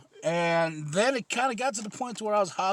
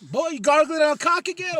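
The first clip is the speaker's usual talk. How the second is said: mocking buddy voice; 10 semitones higher, 6 dB louder than usual. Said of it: "I was all".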